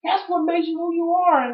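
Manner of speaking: high, whiny mock plea; mock pleading. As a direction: beg the Rock like a heartbroken fan. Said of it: "I was like".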